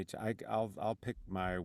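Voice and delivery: ordinary talk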